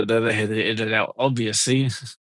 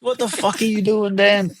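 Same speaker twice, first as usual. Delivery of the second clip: imitating wife's outburst; 6 semitones higher than usual. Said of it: "She's like".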